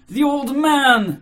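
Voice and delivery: high, loud, drawn-out outburst; frenzied cry. Acted. Mitya answers the officials, crying out in a frenzy. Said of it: "Mitya cried in a frenzy".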